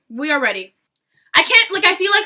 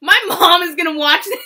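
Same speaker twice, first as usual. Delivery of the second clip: panicked exclaim; 5 semitones higher, 12 dB louder than usual. Real, not acted. Panic at cringe content.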